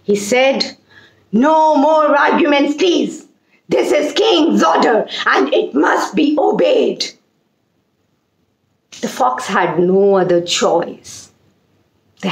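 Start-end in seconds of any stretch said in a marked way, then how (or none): 1.3-7.1 angry king. Acted. Lion king silencing his minister in anger. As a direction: lay down the law as a furious king. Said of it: "very angry".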